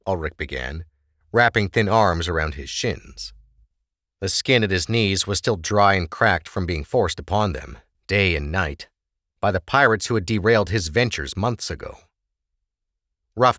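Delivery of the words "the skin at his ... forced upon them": ordinary talk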